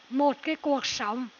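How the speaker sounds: ordinary delivery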